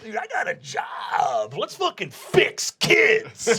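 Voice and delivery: a laid-back surfer werewolf yelling hey; hyped werewolf bro; party werewolf dude. Acted. Werewolf stoner voice.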